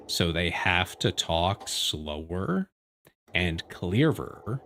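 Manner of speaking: slow, deliberately clear words; mock slow careful speech. Shown as a demonstration. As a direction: spell it out slowly like a robot voice user. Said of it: "I was doing a bit of a bit there".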